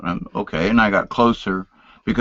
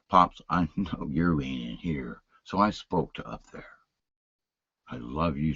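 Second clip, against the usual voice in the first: much softer and lower, almost whispered prayer; saying goodbye to a dead father; tender farewell; 8 semitones lower, 10 dB quieter than usual. Retold or imitated. Son speaks to dead father.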